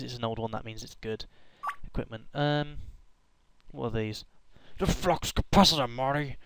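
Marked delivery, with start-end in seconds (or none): 4.8-6.4 failed Doc impression. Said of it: "I can't do the voice".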